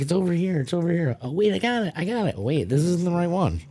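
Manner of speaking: high-pitched, hurried little discoveries; mock eager searching. Teasing clue hunters on the trail, high mock excitement. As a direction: act out players finding then doubting a clue. Acting out players hunting for clues.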